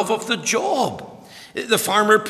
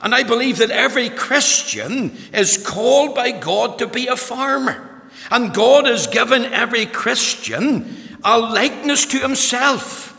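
raised, ringing pulpit voice; preaching at full force; 6 semitones higher, 5 dB louder than usual. Acted. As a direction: preach it out loud and high. Opening of sermon, full force.

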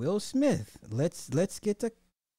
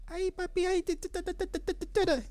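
much higher, breathy-free sing-song Spanish purr; mock Spanish flirt; faster than usual. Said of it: "say that shit in Spanish".

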